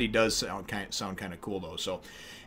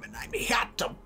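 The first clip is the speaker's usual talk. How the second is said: whisper; 11 semitones higher, 5 dB quieter than usual. Acted. Whispered aside in intro.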